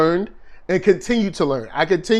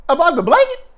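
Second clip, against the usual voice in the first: surprised question; 10 semitones higher, 4 dB louder than usual. Real, not acted. Asking in surprise.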